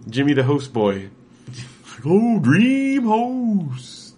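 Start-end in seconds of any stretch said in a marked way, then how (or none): 2.1-4.1 high, slow cartoon mascot voice. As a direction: play a goofy kids' cereal mascot. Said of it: "that's my cartoon character for it".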